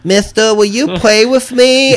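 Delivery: put-on high, slow creepy little girl voice; eerie child asking to play; too calm creepy doll. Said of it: "that little girl turns around and goes".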